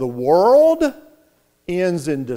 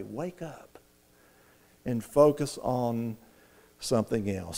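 soft, tender voice; 3 semitones lower, 9 dB quieter than usual. Acted. Preacher to his congregation, a hushed tender plea. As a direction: drop to a near hush, gently pleading. Tender pause-filled quote of Jesus.

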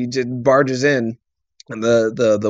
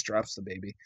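ordinary talk, nothing marked

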